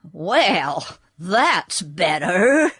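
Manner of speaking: slow relieved horse voice. Acted. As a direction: let the tension go, unhurried. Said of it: "in a relieved tone of voice".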